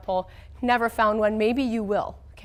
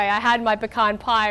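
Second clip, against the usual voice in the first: ordinary delivery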